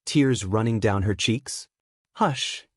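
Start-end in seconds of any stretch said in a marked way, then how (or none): 2.1-2.7 tearful hush. A narrator's reading. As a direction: hush her gently through your own tears. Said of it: "choking words out between sobs".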